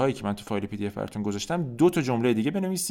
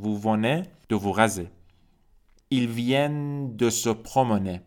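"Le métro vient de partir" said slowly, each word separate; slow, careful French for learners; slow model reading; much slower than usual. Shown as a demonstration. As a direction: drill it slowly, stressing vient. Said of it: "reads French model sentences".

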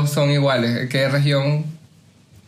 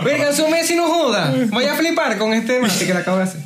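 mock Messi boast; 9 semitones higher than usual. Mocking Messi showing off mate.

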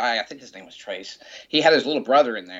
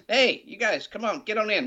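high-pitched mock call from a car; acted, eager invitation pitched up; 11 semitones higher than usual. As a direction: hail them over to the car. Driver calling band over to car.

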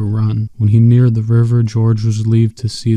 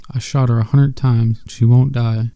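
plain reading voice

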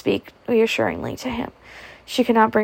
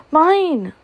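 put-on child's cry; 8 semitones higher, 3 dB louder than usual. A narrator's reading. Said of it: "he cried".

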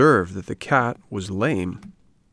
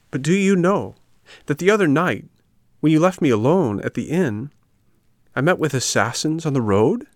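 higher, excited telling; agitated puppet; 7 semitones higher, 4 dB louder than usual. Acted. Puppet telling friends of assassins, higher agitated voice. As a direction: blurt the news out, pitched up. Puppet tells alarming news.

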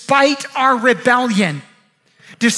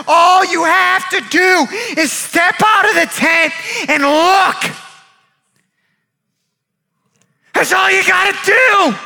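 urgent pleading; 4 semitones higher than usual. Acted. Friend begs bitten man to step out and look, urgent pleading. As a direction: cry it out, building to the last words.

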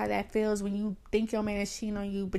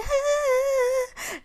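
mock drunk wail; 4 dB louder than usual. Drunk girl in bathroom crying out.

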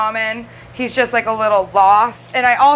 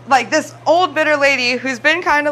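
ordinary delivery

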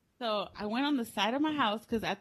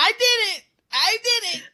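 loud, high mock outburst; mock confession; 11 semitones higher, 11 dB louder than usual. Child confessing to grandmother, loud mock outburst. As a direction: blurt it out like a child owning up.

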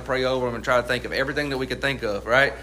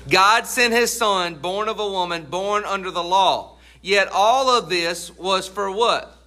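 preaching; 5 semitones higher, slower than usual. Acted.

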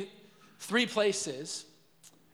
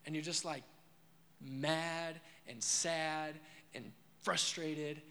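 softer, very slow, weighed-down words; mild heavy sadness; 3 semitones lower, 7 dB quieter, much slower than usual. Acted. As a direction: let "mad and sad" drag out softly. Describing a hot cry.

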